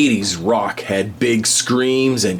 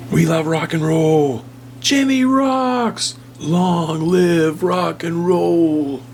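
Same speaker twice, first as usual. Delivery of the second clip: cheering rock fans; 8 semitones higher than usual. Acted. Fans cheering their rock idols.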